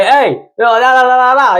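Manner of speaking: high, loud call across the lot; calling out to a guest walking away; retold yell. Valet yelling after a departing guest. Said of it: "You know, I'm yelling at him".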